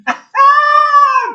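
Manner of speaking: a sudden high, loud burst of laughter. Real, not acted. Ranting to viewers, then a loud high laugh. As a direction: cackle out loud at the joke.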